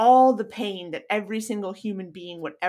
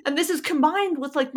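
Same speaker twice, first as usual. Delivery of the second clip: ordinary talk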